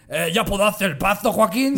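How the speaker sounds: llamado fuerte y agudo con voz puesta; grítale al empleado en son de burla; jefe burlón. Acted. Imita al jefe otaku gritándole. (jefe mandón de parodia)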